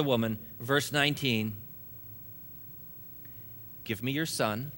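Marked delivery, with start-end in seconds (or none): 3.8-4.7 soft, lower, tender request; gentle, merciful. A narrator's reading. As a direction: ask for the boy quietly and kindly. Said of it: "the prophet gently and mercifully says".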